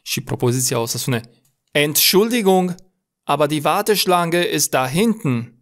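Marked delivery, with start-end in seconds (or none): 1.7-5.6 annoyed-style "Entschuldigung" with ENT hit hard, higher pitch; stressed first syllable. Shown as a demonstration. Teacher shows how to rebuke a queue-jumper, stress on ENT in "Entschuldigung". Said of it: "observați cum o să accentuez primele trei litere din Entschuldigung".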